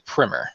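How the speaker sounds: "primer" said as "primmer"; short i in "primer", rhyming with swimmer; short-i pronunciation. Shown as a demonstration. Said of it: "I say primer".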